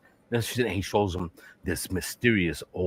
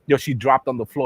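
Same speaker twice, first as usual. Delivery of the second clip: ordinary delivery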